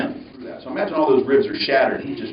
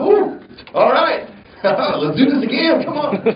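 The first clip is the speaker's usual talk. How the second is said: high, louder, slow with a laugh, pumped up; a jolted-awake thrill seeker ready for more; giddy rush; 5 dB louder, slower than usual. Retold or imitated. Revived, jokes wanting more.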